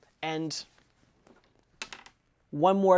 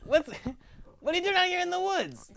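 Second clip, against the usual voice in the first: higher, louder cheery goblin voice; friendly goblin greeting; 7 semitones higher, 3 dB louder than usual. Acted. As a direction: hail him like an old pal, pitched up. Drew greets the impaled goblin.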